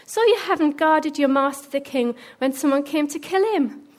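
high, loud, jeering challenge; called-out mocking accusation; taunting. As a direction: shame the sleeping guard from afar. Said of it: "David taunted".

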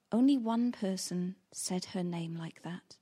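ordinary talk, nothing marked

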